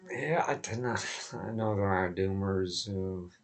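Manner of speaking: ordinary delivery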